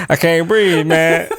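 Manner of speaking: a touch of breathless panic; demo can't breathe. Shown as a demonstration. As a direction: show the mild strained plea. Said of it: "Like that, right?".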